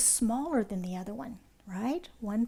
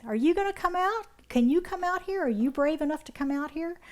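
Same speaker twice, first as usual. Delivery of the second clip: put-on taunting kids; 6 semitones higher than usual.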